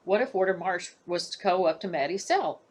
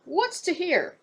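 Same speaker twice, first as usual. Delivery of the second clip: much higher, put-on old warder voice; gruff warder; 11 semitones higher, slower than usual. Warder scoffs at the idea of hearing anything. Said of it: "Warder Marsh grumbled".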